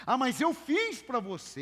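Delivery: hammed-up guilt trip; mock indignant reproach. Preacher voicing a friend who demands a favor back, offended.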